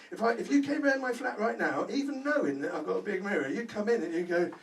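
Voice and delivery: ordinary talk, nothing marked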